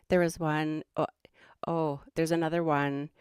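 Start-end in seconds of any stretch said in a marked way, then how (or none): none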